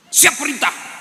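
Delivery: loud, high snappy reply; eager aide. Acted. Aide answering his commander, loud and eager. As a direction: snap to attention.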